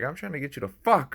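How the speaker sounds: lightly voiced Gary Vee saying it wrong; mock Gary Vee slip. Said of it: "He's like".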